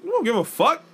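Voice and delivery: loud high careless answer; mock kids' bravado; defiant kids.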